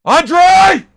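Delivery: high, full-throated shout for a family member; real calling-out yell with a long held ending; yell upstairs. Real, not acted. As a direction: holler the name up through the house. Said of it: "That's why I yell up to my family".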